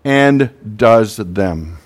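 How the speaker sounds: "and does them" slowed right down, each word weighted; stress on and does them. Shown as a demonstration. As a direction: lean slowly on "and does them" so it sticks. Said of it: "I would encourage you to underline the word".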